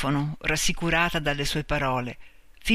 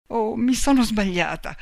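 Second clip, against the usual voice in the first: higher, dismayed realisation; distressed thought; 6 semitones higher than usual. A narrator's reading. Marta realises her mistake beside the fallen man, distressed. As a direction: think it aloud in rising distress. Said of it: "pensò".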